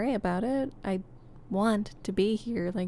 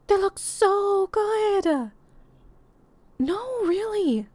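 excited; 9 semitones higher, 4 dB louder, slower than usual. Acted. Thrilled about the photos.

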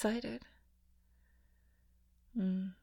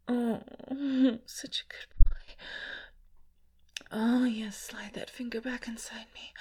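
breathy intimate whisper, close to the mic; sensual ASMR whisper; 8 dB louder than usual. Acted.

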